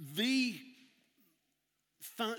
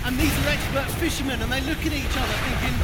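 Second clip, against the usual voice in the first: loud and higher, yelling over the wind; shouting over the storm; 7 semitones higher, 13 dB louder than usual. Acted. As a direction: shout like you're caught in a gale. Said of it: "it's gonna get very loud".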